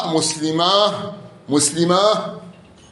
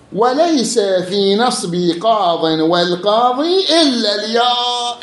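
تلاوة بطيئة ممدودة للمتن; رتّل البيت ببطء وارفع آخره; قراءة المتن; 5 semitones higher, slower than usual. Acted. يقرأ متن النحو. (قراءة متن ممدودة)

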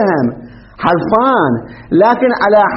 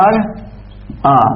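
drawn out slowly; 5 semitones lower, 4 dB quieter, much slower than usual. Shown as a demonstration. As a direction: stretch أرأى very slowly, syllable by syllable. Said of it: "شنو أصلها؟". (مدّ الكلمة ببطء شديد)

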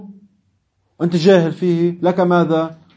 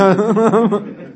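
short, higher-pitched laugh; real laugh; 4 semitones higher than usual. Real, not acted. Teacher joking with students about threats, short laugh. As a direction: break into a brief laugh.

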